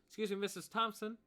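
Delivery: put-on high, loud polite girl; young woman's voice. Abigail addressing the barkeep, high and polite. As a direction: politely get the barkeep's attention. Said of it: "She'll say to the barkeep".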